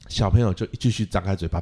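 ordinary talk, nothing marked

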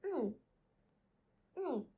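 "Mm" hummed with a quick fall; tone demonstration. Shown as a demonstration. Teacher modelling for students to repeat, falling tone on "Mm". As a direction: hum each "Mm" separately, dropping in pitch. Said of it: "Repeat after me for two times".

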